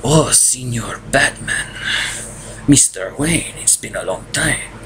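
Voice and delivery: put-on slow Spanish-accented comic villain; South American accent. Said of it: "or South American accent".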